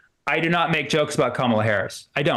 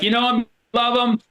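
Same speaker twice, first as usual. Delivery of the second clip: host intro; 6 semitones higher than usual. Pretend. Host introducing him onstage.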